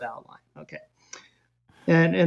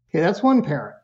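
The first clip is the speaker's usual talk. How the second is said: ordinary delivery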